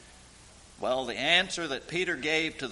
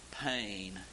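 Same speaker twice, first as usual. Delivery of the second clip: normal talking voice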